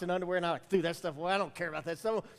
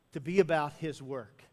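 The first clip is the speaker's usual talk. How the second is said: usual speaking voice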